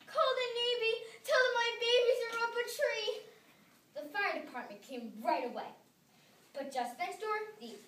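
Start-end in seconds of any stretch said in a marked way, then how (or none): 0.1-3.2 high, loud panicked plea; frantic cry for help. A narrator's reading. Missus Bisbee pleading for her cats in the tree, high and loud. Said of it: "she cried".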